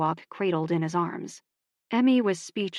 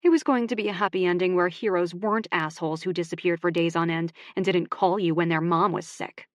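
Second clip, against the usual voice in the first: ordinary delivery, nothing marked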